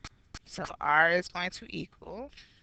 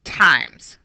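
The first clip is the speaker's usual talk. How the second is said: "times" punched out louder; emphasized word; 9 dB louder than usual. Shown as a demonstration. Teacher pointing out multiplication to students, "times" emphasized. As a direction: hit "times" hard with a falling finish. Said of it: "I emphasize the word".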